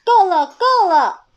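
高声大喊够了; 急着大喊制止; 大声喊停. Acted. 妈妈喊停. (高而大声地喊够了)

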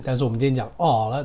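normal talking voice

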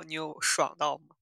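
ordinary talk, nothing marked

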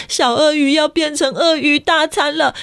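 plain reading voice

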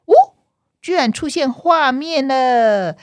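amazed. A narrator's reading. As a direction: draw out the wonder. Surprise when the picture appears.

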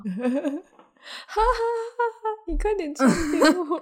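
high, laughing mock quote. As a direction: giggle through the words.

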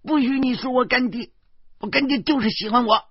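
put-on high, loud childish protest; 小女孩不服气; defiant little girl. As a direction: stand up for her godfather like a small kid. Little girl defends her godfather.

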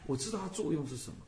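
normal talking voice

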